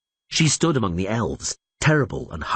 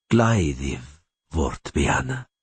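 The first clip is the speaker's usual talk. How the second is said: slow, low, unruffled command; calm voice; 4 semitones lower, slower than usual. A narrator's reading. Elf rider repeats his demand to Rayla, calmly. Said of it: "repeated the rider".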